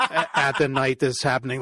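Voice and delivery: ordinary talk, nothing marked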